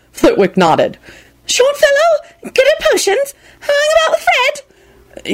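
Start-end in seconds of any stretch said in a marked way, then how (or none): 1.5-5.3 put-on very high, slow, piping old wizard; squeaky little professor. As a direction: pipe up like a tiny professor. Flitwick's tiny professor voice.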